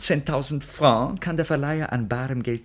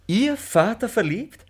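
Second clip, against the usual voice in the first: astonished; 10 semitones higher, 5 dB louder, slower than usual. Acted. Servant astonished.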